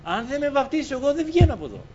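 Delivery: a high, pleading demand to God; man locked in a room insisting on the Holy Spirit; desperate prayer. Retold or imitated. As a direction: beg the Lord and refuse to give in. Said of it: "και είπε".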